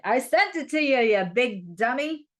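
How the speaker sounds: exasperated universe. Retold or imitated. The universe tells Scorpio it sent love, hammed-up exasperation. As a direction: scold them like a fond, fed-up friend. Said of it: "universe is like".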